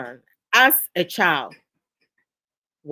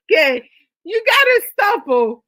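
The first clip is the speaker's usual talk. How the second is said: higher and louder, still laughing; laughing plea; 11 semitones higher, 8 dB louder than usual. Real, not acted. Host pleading with the viewer to stop, laughing hard. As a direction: beg them to stop through the laughter.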